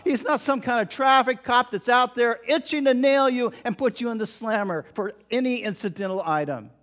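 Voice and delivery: ordinary delivery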